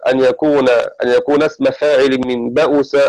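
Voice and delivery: ordinary talk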